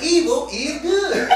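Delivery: mock vampire quoting a film line; movie vampire line. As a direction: savour it like a sly movie vampire. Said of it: "A Vampire in Brooklyn? When he was like".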